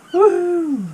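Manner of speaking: high whoop of excitement; excited. Real, not acted. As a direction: cheer it out loud. Excited cheer starting the trip.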